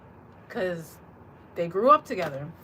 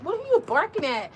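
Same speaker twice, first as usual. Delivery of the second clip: higher pitched, curious; puzzled question to dog; 8 semitones higher, 3 dB louder than usual. Retold or imitated. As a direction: ask the dog what's up. Talking to her barking dog.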